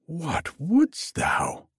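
high, slow questioning cry; startled cry. A narrator's reading. Dreamer to the old man at his bedside, crying out. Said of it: "I cried".

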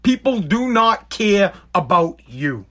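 slow, each word weighted, People do not care; emphatic repetition. Real, not acted. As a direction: lean hard on do and not. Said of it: "I'm gonna tell it to you straight".

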